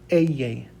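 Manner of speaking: "eye" said as "EH-yeh"; Spanish double L. Shown as a demonstration. Teacher demonstrating the common double L, "eye" as EH-yeh. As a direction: model "eye" with a y sound, falling. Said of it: "most common way that I hear it".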